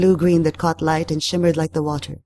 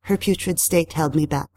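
plain reading voice

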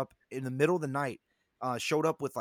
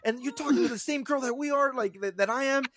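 high, indignant mock rant; imitated yelling; 8 semitones higher than usual. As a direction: retell a friend's outraged complaint, pitched high. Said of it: "he's yelling".

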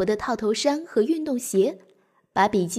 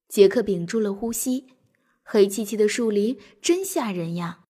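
plain reading voice

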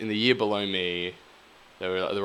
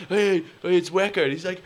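much higher, cheery bloke voices; high mates' greeting; 11 semitones higher than usual. Retold or imitated. Rugby boys hail Weka at the club, high and cheery. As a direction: greet him like an old mate.

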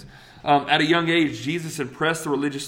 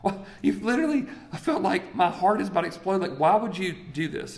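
high-pitched mock exasperation; a hint of a worried mom scolding; frustrated mother; 5 semitones higher than usual. Mary scolding Jesus after three days searching, high mock exasperation. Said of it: "they look at him and go".

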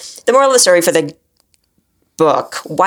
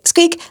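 squeak; 12 semitones higher than usual. Pretend. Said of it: "Sorry, squeak".

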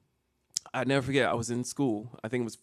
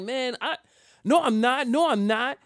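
put-on high, loud protest of a teased kid; flustered defensiveness; 10 semitones higher, 4 dB louder, slower than usual. Schoolboy defending himself to taunting classmates, flustered protest. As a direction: blurt out a higher-pitched denial. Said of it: "how really how to defend myself".

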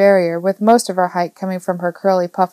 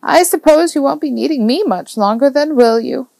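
put-on higher, lilting old woman with a touch of sadness; wistful old woman; 6 semitones higher than usual. A narrator's reading. Old neighbour fears the boy outgrows her, wistful. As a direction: sound gently forlorn as the elderly neighbour. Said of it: "She looked so sad as she said it".

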